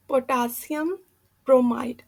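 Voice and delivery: ordinary talk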